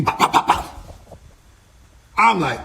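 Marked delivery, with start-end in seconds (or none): 0.0-1.2 voiceless popping hit noises; imitated punch sounds. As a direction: pop out each blow like a slow volley of punches. Imitates the blows being landed.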